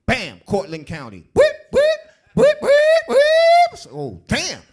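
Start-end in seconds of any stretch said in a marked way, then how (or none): 1.3-3.2 police siren. Retold or imitated.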